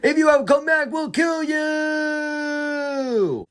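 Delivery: high, drawn-out shouted threat; threatening hyena shout. Retold or imitated. Hyenas shouting after the fleeing cub, high and drawn out. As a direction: scream the threat after him, stretched out. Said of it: "the hyena said".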